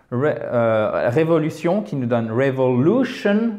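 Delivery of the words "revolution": "revolution" with an exaggerated stressed LU; caricatured word stress. Shown as a demonstration. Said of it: "Alors là, je caricature". (accent tonique caricaturé sur le LU de « revolution »)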